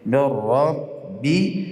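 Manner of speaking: من ربی chanted very slowly, no nasal sound; recitation without nasal. Shown as a demonstration. Teacher models من ربی correctly for students. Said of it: "نه".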